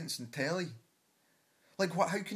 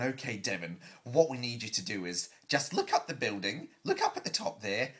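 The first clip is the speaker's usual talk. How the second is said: brusque producer; 5 semitones lower than usual. Retold or imitated. Producer staging a contestant's exit, lightly voiced and dismissive. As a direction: imitate a producer giving matter-of-fact orders, ending dismissively. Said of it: "stopped by the producer, and he's gone".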